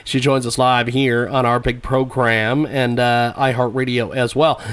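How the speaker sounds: low, steady promo read; radio announcer. Acted. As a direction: read it like a station plug. Host repeats show plug.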